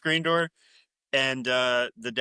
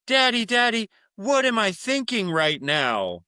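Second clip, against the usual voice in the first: little daughter voice; 7 semitones higher, 4 dB louder than usual. Retold or imitated. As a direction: play the small daughter asking her daddy. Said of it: "and goes".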